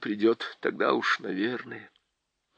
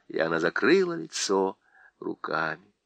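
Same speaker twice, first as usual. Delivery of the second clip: plain reading voice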